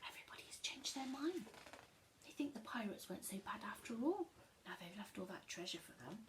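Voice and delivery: fast soft whisper; quick whispered aside. Real, not acted. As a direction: rush it in a hush.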